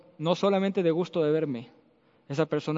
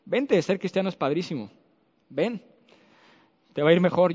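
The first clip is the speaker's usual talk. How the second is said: mocking cheerful pitch; 2 semitones higher than usual. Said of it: "me da mucha risa cuando dicen".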